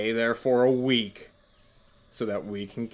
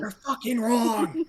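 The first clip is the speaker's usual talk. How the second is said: high-pitched whisper yell, rising at the end; a hushed camp quarrel, pitched high; 11 semitones higher than usual. Pretend. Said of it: "We whisper yell at each other".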